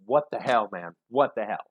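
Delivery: loud exasperated what the hell; angry outburst. Retold or imitated. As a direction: throw it out, fed up and angry. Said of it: "And I'm hearing".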